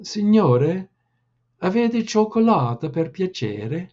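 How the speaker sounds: begging in a lightly voiced southern Italian accent; boy pleading with American soldiers, southern Italian accent. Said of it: "Lino implored, in his southern accent".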